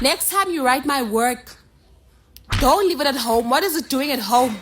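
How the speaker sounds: strict teacher scolding; firm loud order. Acted. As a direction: summon the pupil firmly. Teacher ordering pupil forward.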